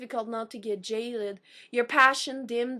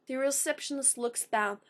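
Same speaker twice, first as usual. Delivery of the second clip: ordinary delivery, nothing marked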